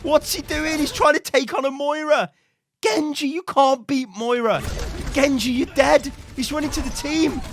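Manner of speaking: high, urgent shouts at the players; excited calling out. Real, not acted. Coach to Junkrat and doomed Genji, excited calling. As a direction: yell at the replay in exasperated excitement.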